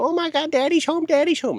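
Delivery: very high, squealing excited voice; excited baby talk. Retold or imitated. Dog owner home on the kitchen floor greeting his dogs, high squealing baby talk. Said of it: "and I go".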